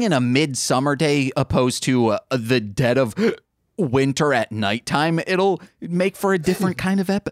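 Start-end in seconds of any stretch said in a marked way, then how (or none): 3.5-3.8 hiccuping mid-sentence; a hiccup breaks the words. Real, not acted. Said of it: "I have the hiccups".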